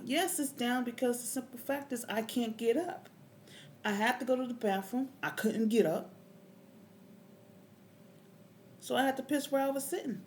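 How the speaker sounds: loud, high-pitched exasperated protest; indignant retort. Retold or imitated.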